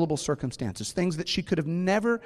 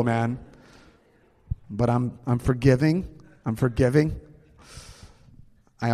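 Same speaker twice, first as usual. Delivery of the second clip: normal talking voice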